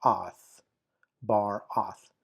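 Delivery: "bar-oth" broken between r and oth; "bar-oth", second syllable begun without the r; wrongly split syllables. Shown as a demonstration. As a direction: say "oth" as its own syllable, starting on the vowel. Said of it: "It's not bar and then".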